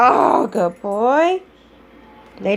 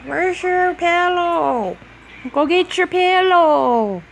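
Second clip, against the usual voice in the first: slow, high sing-song coaxing; 5 semitones higher, slower than usual. Real, not acted. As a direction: coo at the puppy slowly. Coaxing the dog.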